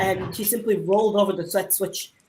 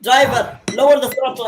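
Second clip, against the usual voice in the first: urgent call; 4 semitones higher, 7 dB louder than usual. Acted. Engine slipping, calls driver.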